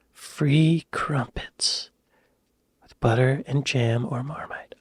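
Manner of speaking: unvoiced hushed whisper; awed whisper about the crumpets. Real, not acted. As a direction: breathe it out in disbelief. Dumbfounded aside about crumpet truck.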